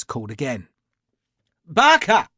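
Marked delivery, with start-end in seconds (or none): 1.6-2.3 loud, high hail from far up the hill; calling out. A narrator's reading. Lavisser hailing his servant from uphill, loud call. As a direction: shout a name across the trees.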